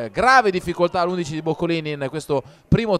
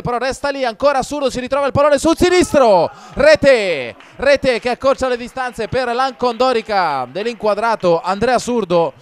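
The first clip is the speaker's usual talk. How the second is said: higher and louder goal cry; breathless italian goal commentary; 4 semitones higher, 5 dB louder than usual. Real, not acted. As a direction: burst out with the goal call. Goal scored, live call.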